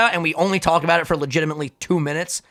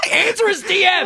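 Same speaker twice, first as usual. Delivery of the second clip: excited outburst; 13 semitones higher, 3 dB louder than usual. Real, not acted. Laughing outburst at friend.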